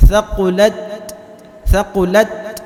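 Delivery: thaqulat with a puff of air released on the final t; Arabic letter ta. Shown as a demonstration. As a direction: let the t of thaqulat burst out with breath. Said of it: "seperti itu cara membaca huruf, huruf ta".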